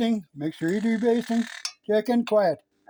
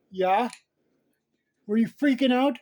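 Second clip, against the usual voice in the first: normal talking voice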